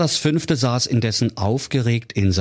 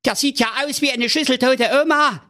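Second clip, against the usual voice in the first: very high, shrill-edged king voice, aghast; high king appalled at the remains; horrified king; faster than usual. A narrator's reading. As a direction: recoil like a squeamish monarch. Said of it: "rief der König entsetzt".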